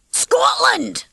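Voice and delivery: very high, joyful Scottish cry. A narrator's reading. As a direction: cry it out in delight. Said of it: "cried Mac joyfully".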